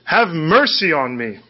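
mock shout, stretched out at the end; imitated yelling. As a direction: call out like a beggar yelling over a crowd. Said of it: "yelling at the top of his lungs".

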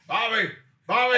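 put-on loud high drunken shout; booming tipsy uncle. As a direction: holler like a tipsy uncle showing off. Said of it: "sounds like my uncle, my drunk uncle".

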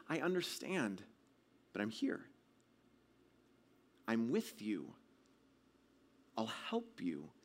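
soft, low voice with long pauses; calm a frightened child quietly; gentle reassurance. Retold or imitated. Dad comforting his trapped son, gentle reassurance.

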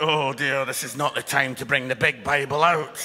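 low, loud mock-embarrassed aside; mock dismay. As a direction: play up the "oh dear" for laughs. Mocking embarrassed Bible carrier in audience.

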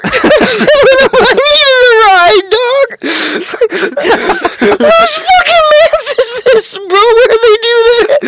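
high, slow words squeezed through real laughter; cracking up. Real, not acted. Telling friend the hooker story, crying with laughter. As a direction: laugh so hard the words barely come out.

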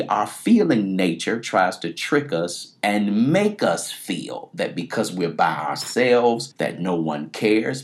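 ordinary delivery

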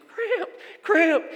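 high, loud hammed-up cramp yelp; imitating a struggling swimmer's loud cry; mock cry for help. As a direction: call out in exaggerated high-pitched distress.

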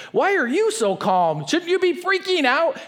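mock exasperated friend; incredulous. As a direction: ask it in bewildered disbelief. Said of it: "you're like".

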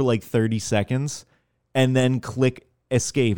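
ordinary talk

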